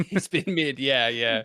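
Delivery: laughing through the words. Real, not acted. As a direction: chuckle it out, amused. Joking about a mid player.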